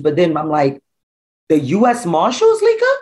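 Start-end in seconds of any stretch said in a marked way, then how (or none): 1.5-2.9 alarmed disbelief. Retold or imitated. Mother hearing from girlfriend that marshals arrested son, shocked high cry.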